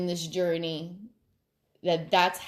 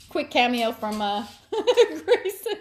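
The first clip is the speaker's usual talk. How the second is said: much higher and louder, cracking up; laughing intro; 9 semitones higher, 5 dB louder than usual. Real, not acted. Introducing her pet's cameo to viewers, through high laughter. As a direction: announce him through the giggles.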